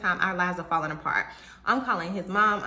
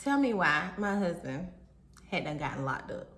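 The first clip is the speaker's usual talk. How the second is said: ordinary talk, nothing marked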